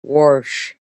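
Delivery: Pittsburgh "warsh" with an added R; imitated Pittsburgh pronunciation; Pittsburgh accent. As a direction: say it like a Pittsburgh local. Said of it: "'cause they go".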